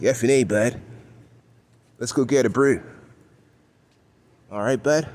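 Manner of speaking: hammed-up "hey bud" Toronto accent. Buddy asking friends out for a brew, mock Toronto accent. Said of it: "somebody else might get with their friends and be like".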